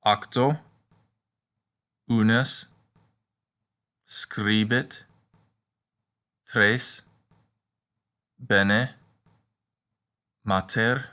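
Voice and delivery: each word said alone, rising, like flashcards; review drill. Shown as a demonstration. Teacher reviewing Latin practice words one by one. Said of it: "Let's review our practice words".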